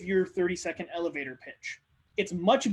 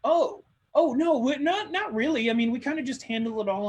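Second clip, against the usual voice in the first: higher, brighter reply voice; Sally answering; 6 semitones higher than usual. Acted. Sally answering about employees, a higher brushing-off voice. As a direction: play the other person brushing it off.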